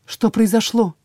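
usual speaking voice

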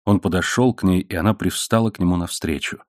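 plain reading voice

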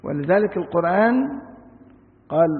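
ordinary delivery

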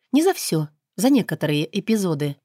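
plain reading voice

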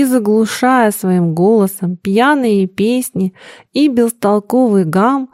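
usual speaking voice

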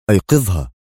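a sharp, clipped order; sharp command. A narrator's reading. Lawyer orders the guard to wake her, sharp. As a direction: bite the word off. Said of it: "قال مانتراك في حدة".

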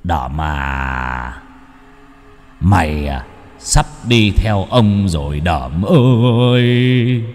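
slow sing-song ghostly croon; a dead man's voice calling his victim to come along; eerie ghost voice. Acted. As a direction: lure him gently toward death. Ghost lures man to death.

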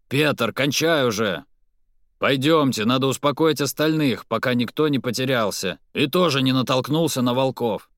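loud, commanding call to others; rally the group loudly. Acted. Hakon rallies men in forest.

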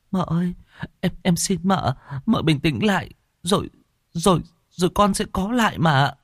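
nervous maid stalling the mistress; timid young maid. Acted. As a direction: stammer out an answer to your mistress. Maid comforts her mistress.

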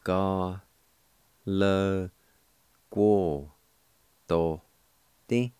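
Ka, le, guo, do, di said as separate syllables, each with a pause between. Shown as a demonstration. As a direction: say Ka, le, guo, do, di one syllable at a time. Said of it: "is pronounced like this".

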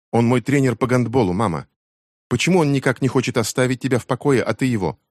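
an angry daughter throwing blame; teen daughter. A narrator's reading. Becky resents the coach. (обиженная дочь-подросток, выше и громче)

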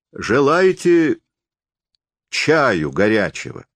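high, drawn-out tipsy question; drunken offer. A narrator's reading. Forester offering guests tea, drunkenly. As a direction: slur the offer like a drunk host. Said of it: "пьяно спросил лесник".

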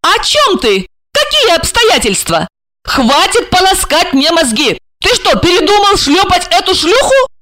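very high, exasperated questioning; high-pitched female outrage; indignant woman. Acted. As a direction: demand answers in a high voice. Plays Regina, indignant.

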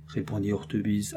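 ordinary delivery, nothing marked

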